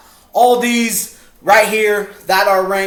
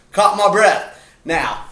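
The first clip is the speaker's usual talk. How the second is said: ordinary talk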